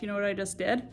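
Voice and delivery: ordinary delivery, nothing marked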